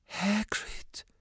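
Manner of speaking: high, soft anxious call; alarmed girl reaching out; Hermione calling. A narrator's reading. Hermione calling to sobbing Hagrid, high but soft. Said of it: "rief Hermine".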